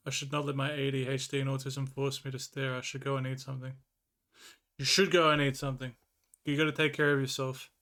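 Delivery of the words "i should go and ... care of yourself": normal talking voice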